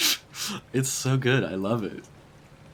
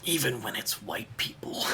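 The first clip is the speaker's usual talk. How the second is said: whisper; 3 semitones higher, 5 dB quieter than usual. Real, not acted. Joking aside after serious point.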